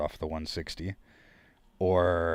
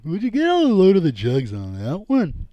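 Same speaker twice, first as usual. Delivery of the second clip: much higher, louder crude leering voice; favorite comedy bit; 9 semitones higher, 8 dB louder than usual. Retold or imitated. Quoting his favorite bit to the chat, loud leering voice. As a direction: bellow it like a leering comic. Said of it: "My favorite one".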